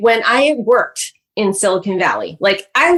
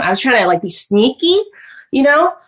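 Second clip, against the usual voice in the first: ordinary delivery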